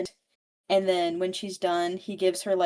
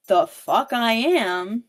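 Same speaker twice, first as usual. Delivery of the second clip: slow, louder, stretched-out defiance; defiant retort; 3 semitones higher, 7 dB louder, slower than usual. Retold or imitated. Evie snapping back at the villain, loud defiance. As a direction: throw it back at him, daring.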